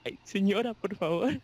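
high, bashful protest; a flustered young seller waving off the praise; embarrassed protest. Retold or imitated. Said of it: "y así como de". (protesta avergonzada, aguda)

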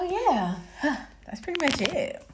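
usual speaking voice

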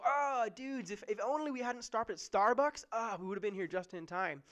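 exaggerated casual moan; mock frustrated lament. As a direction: gripe about the coffee stop, half joking.

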